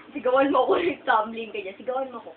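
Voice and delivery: ordinary talk, nothing marked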